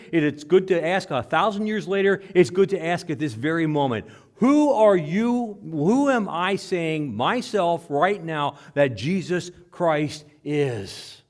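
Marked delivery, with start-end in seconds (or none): 4.4-11.2 loud and slow, leaning on who; emphatic challenge. Real, not acted. Preacher challenging his congregation, loud and slow. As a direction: drive the question at each listener. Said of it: "preacher asks listeners now".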